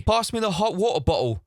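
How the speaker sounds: put-on high English accent, every T swallowed; mock English accent. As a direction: imitate an Englishman with glottal stops. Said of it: "not a single T".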